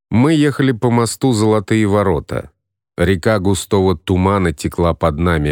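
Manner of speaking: ordinary delivery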